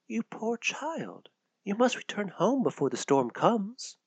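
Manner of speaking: soft caring stranger in the woods; tender hooded woman. Acted. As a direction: comfort a lost child gently. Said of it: "the woman told".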